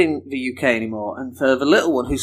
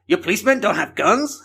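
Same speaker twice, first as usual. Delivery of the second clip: incredulous put-on American, higher; American accent; 5 semitones higher than usual. American asks about unarmed British police, put-on American accent. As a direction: question it as a mock-amazed American. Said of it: "that's my American accent".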